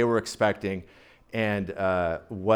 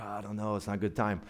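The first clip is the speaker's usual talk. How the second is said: whispering; 6 semitones lower, 13 dB quieter, faster than usual. Acted. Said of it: "like, whispering".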